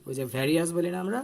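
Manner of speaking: usual speaking voice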